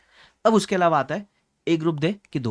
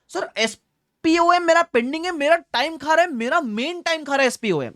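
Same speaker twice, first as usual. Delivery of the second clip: much higher, slow complaining student imitation; mock whiny student; 9 semitones higher than usual. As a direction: mimic a fretting student, pitched way up. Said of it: "ये एक सवाल और आता है". (ऊँची आवाज़ में परेशान छात्र की नकल)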